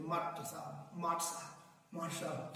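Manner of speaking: माट साहब repeated softly as "maat-saab"; colloquial contraction. Shown as a demonstration. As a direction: quietly run माट साहब together, "master" worn down to "maat". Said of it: "कुछ लोग माट साहब ही बोलते थे".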